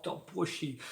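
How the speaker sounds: lightly imitated Indian Connery accent, softened; a hint of Indian Connery, "pushy" ending on Octopussy; Indian-tinged Sean Connery accent. Reviewer repeats the villain's catchphrase, light Indian Connery accent. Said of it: "like an Indian version of Sean Connery".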